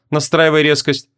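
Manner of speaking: ordinary delivery, nothing marked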